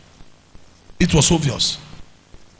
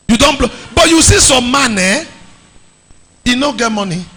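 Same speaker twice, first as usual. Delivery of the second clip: ordinary delivery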